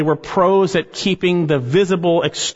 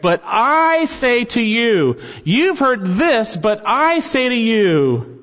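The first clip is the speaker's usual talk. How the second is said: slow and weighty, higher, leaning on I; emphatic; 7 semitones higher, slower than usual. Acted. Pastor voicing Jesus to the congregation, emphatic and slow. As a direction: proclaim it with full emphasis, each word placed slowly. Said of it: "it is emphatic in the Greek text".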